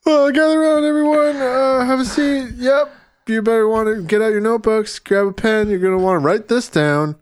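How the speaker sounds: high, loud lecturing professor voice. Pretend. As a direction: address the lecture hall.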